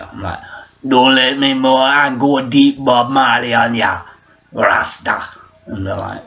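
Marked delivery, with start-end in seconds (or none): 0.9-5.2 raised, louder Jamaican patois; put-on Rasta bragging in thick Jamaican; mock Jamaican Rastafarian boast. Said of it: "that's from my Rasta voice".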